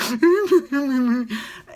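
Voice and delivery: high teary laugh bursting out; crying laugh. Real, not acted. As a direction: laugh through tears, loud and high. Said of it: "I'm like".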